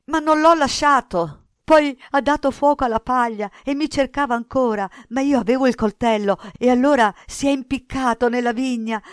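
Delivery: high, loud, panicked account; desperate cries tumbling out; frantic shouting. A narrator's reading. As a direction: pour it out as a terrified boy. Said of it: "gridò Cinto".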